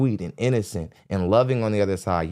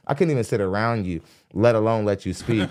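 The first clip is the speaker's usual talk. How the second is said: usual speaking voice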